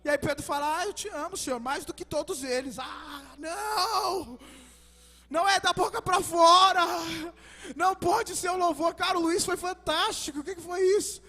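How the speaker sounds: loud, driving sermon delivery; full-force preaching. Acted. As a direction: preach it out to the whole church. (pregação inflamada e em voz alta)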